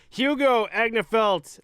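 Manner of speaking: name squeezed out through laughter, high and loud; laughing. Real, not acted. Host laughing as he introduces the goalie's name. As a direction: fight through the giggles to say the name.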